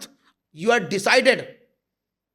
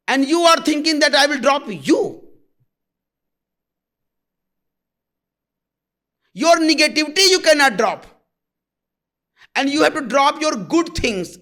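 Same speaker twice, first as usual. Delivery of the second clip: loud, higher, hammering rebuke; scolding shout; 6 semitones higher, 5 dB louder than usual. Real, not acted. Guru scolding seekers who cling to greed, loud and high. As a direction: shout it down at them as a stern teacher.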